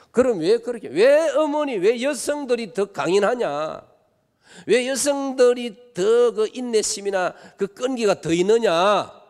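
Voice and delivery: preaching at full force. Real, not acted. Pastor rousing the congregation, loud slow preaching. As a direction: drive each question home to the pews. Preacher builds to rhetorical peak.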